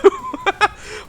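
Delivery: squeaky high-pitched laugh; high giggle. Real, not acted. Laughs at tiny bot.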